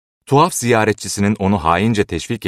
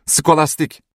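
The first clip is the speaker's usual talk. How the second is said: high-pitched shout for the servant; urgent call; 9 semitones higher, slower than usual. A narrator's reading. Ober summoning the servant to help, calling out high. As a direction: call her name out urgently. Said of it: "diye haykırdı Ober".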